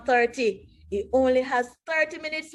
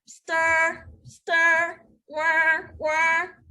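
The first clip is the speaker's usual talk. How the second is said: mixer sound effect; 5 semitones higher, much slower than usual. Acted.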